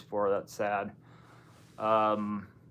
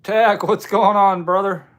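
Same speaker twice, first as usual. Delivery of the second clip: big, raised-voice welcome; hearty greeting; 9 semitones higher, 11 dB louder than usual. Real, not acted. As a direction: call out to a buddy who just arrived. Greeting an arriving viewer by name.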